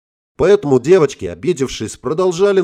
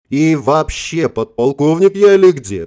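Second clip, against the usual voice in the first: mock indignant; 4 semitones higher, 5 dB louder than usual. A narrator's reading. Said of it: "поинтересовалась я".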